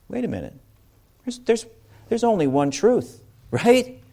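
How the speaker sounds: hammed-up indignant "wait a minute"; mock worked-up protest. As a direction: play the riled-up debater over doctrine. Mock doctrinal argument.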